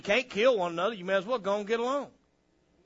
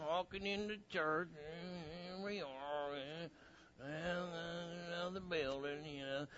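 mock listlessness; 14 dB quieter than usual. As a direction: drag in like a cold, unexcited churchgoer. Mimics churchgoers arriving cold.

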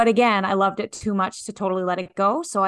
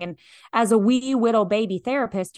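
normal talking voice

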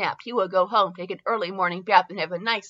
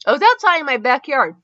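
loud, higher-pitched retake; emphatic restart; 5 semitones higher, 8 dB louder than usual. Real, not acted. Reader restarting after losing their place, emphatic and loud. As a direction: belt out the line to get going.

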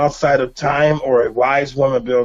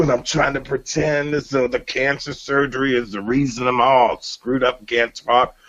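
ordinary delivery

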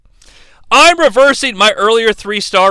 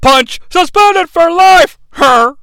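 high, slow, louder outraged yell; Gorilla yelling; 9 semitones higher, 5 dB louder, slower than usual. Retold or imitated. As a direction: bellow it like a shocked old commentator. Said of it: "So it's this".

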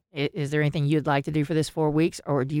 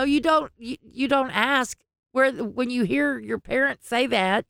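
ordinary talk

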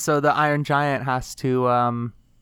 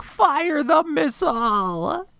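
high, drawn-out mock scream; mock villain yell; 13 semitones higher, much slower than usual. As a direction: yell the order slowly, hammed-up and high. Imitating film villain yelling into walkie-talkie.

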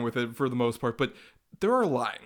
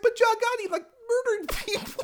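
very high, squeaky indignant voice; exasperated viewer in a falsetto; mock squeaky disbelief; 5 dB louder than usual. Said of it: "It's just like".

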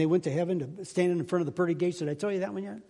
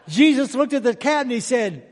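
laughing; 6 semitones higher, 8 dB louder than usual. Real, not acted. Laughing through his own joke.

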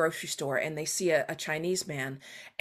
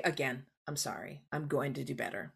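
softer, lower earnest apology; sincere apology; 5 semitones lower, 7 dB quieter than usual. Shown as a demonstration. Author modelling an apology for readers, soft and low. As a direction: apologise gently and mean it.

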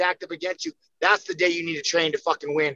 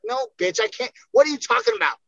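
rising higher and louder to an outburst; mock angry reply; 5 semitones higher, 5 dB louder, faster than usual. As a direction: tell the freeloading friend off, half joking.

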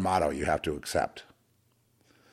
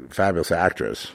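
ordinary talk, nothing marked

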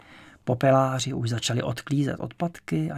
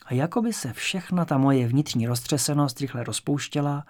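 plain reading voice

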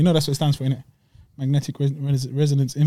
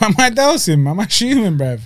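laughing outrage; 6 semitones higher, 3 dB louder than usual. Real, not acted. Mock outrage at gym jibe.

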